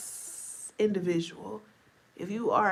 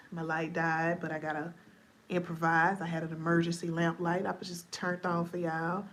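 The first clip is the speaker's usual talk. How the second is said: ordinary talk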